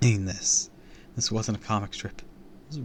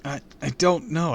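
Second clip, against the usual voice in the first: higher, flustered stammer; 7 semitones higher, 5 dB louder than usual. A narrator's reading. Kid cornered by the villain's question, stammering. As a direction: trip nervously over the first word. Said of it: "I stammered".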